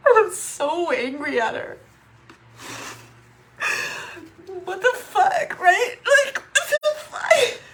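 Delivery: weep the words out in disbelief; crying. Real, not acted. Not knowing why her sister left, crying.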